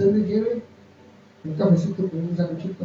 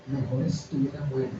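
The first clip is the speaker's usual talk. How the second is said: quiet, lower murmur of a dark thought; un murmullo bajo y repugnante; repugnant voice; 4 semitones lower, 6 dB quieter than usual. Retold or imitated. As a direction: voice the thought softly, as something foul whispering in. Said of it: "así se oye repugnante esa voz".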